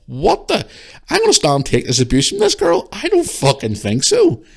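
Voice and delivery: high-pitched disbelief hardening into refusal; indignant refusal. Retold or imitated. Shopper refusing to take abuse, indignant retort. Retelling own indignant thoughts.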